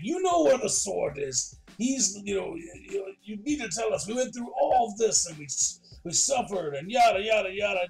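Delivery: mock angry yelling. Angry Jack demanding answers from the monk, mock yelling. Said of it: "starts yelling at the monk".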